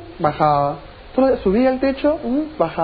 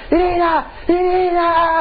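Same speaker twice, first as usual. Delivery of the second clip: high, drawn-out call to someone far off; 9 semitones higher, slower than usual. Acted. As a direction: call her name to get her attention. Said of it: "empiezas a gritar así para llamarla". (llamando a gritos)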